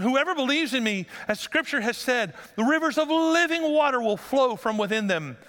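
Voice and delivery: high-pitched scripture proclamation; loud proclamation. Retold or imitated. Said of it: "he said in a loud voice".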